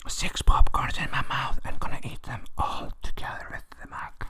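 close-mic whisper. Real, not acted. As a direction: breathe the words right into the mic. Whispering while munching popcorn.

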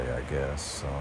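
ordinary talk, nothing marked

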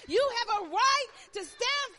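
loud, high, rising preacher's call; full-force preaching. Acted.

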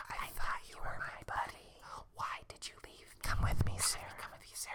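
very soft eerie whisper; ghostly whisper. A narrator's reading. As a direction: whisper it like a ghost at her ear. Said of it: "whisper her saying".

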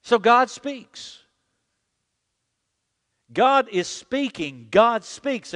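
slow, loud, drawn-out proclamation on God; preacher's booming declaration; full-force preaching. Acted. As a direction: thunder it from the pulpit.

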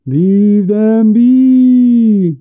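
high, loud wail with long drawn-out vowels; shrill ghostly moan. A narrator's reading. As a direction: moan it slowly like a forest ghost. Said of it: "he said in a shrill moan".

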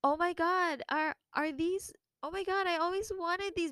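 high, thrilled gushing; excited squeal. Retold or imitated. Retelling her thrilled reaction to the gift box, high and gushing. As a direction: gush over the shoe box. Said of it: "and so I was like".

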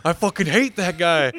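loud, high, drawn-out hate; mock outrage. Remembering the guy who cussed him out, loud mock outrage. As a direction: hammer it out slowly with hammed-up anger. Remembered hatred, acted out loudly.

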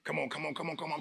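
ordinary talk

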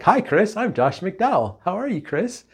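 high-pitched, put-on cheery hello; friendly greeting impression. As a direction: imitate a speaker greeting someone by name tag. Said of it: "He'd be like".

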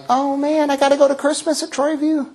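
high, guilty whine about missing church; hammed-up high guilty voice; mock churchgoer. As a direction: play the lapsed churchgoer. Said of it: "you're like".

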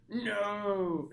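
a mild mock cry of protest; lightly voiced Superman. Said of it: "Superman, he's like".

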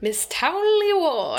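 put-on slow, high-swooping English accent. Podcast host announcing a letter writer's name, hammed-up English accent. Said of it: "say that with the English accent".

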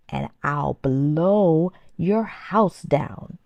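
howling wolf. A narrator's reading. Wolf howling his threat at the straw house. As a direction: stretch every word like a howl. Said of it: "howled the hungry wolf".

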